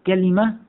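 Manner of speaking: كلمة in three syllables, "ka-li-ma"; full short i after l in كلمة; kalima form. Shown as a demonstration. Teacher recaps three forms for class, first pronounced kalima. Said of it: "في الكلمة ثلاث لغات".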